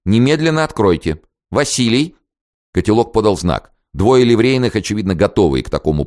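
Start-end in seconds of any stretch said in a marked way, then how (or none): 0.1-2.1 insist, then call for Vasily; demanding. A narrator's reading. Lady demands entry, calls servant.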